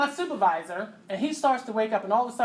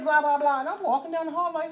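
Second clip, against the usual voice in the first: ordinary talk, nothing marked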